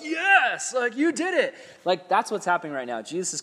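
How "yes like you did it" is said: high, loud victory cheer; mock stadium cheer, pitched way up; cheering fan. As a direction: whoop it up like the game was just won. Crowd cheering at homecoming game.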